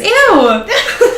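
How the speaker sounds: disgusted "ew" through a laugh. Real, not acted. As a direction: laugh out the ew, high and bright. Friend's odd bookmark habit.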